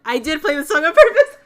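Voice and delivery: loud laughing confession. Real, not acted. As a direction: laugh through the words.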